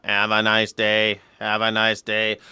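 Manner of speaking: slow, low robot voice; robot greeter. Pretend. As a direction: imitate a robot attendant, slow and measured. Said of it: "replaced, right, by a robot".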